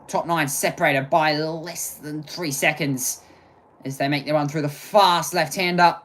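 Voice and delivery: brisk live play-by-play of a sim race; race commentary. Acted. Streamer commentating the race for viewers, brisk play-by-play.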